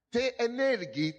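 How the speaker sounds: ordinary talk, nothing marked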